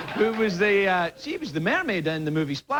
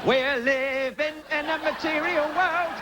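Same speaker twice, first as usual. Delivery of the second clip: high, slow hammed-up [name] with a mock English accent; [name] impression; 10 semitones higher, slower than usual. Comic mocking [name]'s Englishness, high hammed-up sung impression. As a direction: camp it up as a mock-English pop diva singing. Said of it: "You probably thought it was [name]".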